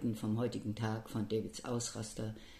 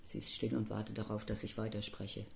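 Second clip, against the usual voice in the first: ordinary delivery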